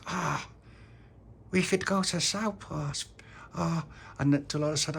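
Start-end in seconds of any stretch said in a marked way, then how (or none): 0.1-3.8 Tony wishing. Acted. Tony dreaming up a birthday wish.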